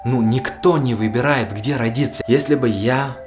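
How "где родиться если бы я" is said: ordinary talk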